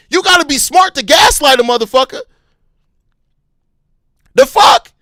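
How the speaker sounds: loud, furious outburst at the Bulls boss; angry shout. Real, not acted. Fan ranting at the Bulls front office, shouting furiously. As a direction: yell it in disbelief. Ranting about the team's manager.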